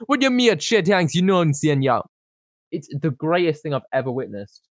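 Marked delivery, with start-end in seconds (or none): 0.1-2.0 high, louder mock Jamaican patter; a hammed-up fake patois. Mocking a celebrity's fake Jamaican accent for his friend. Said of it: "He's like".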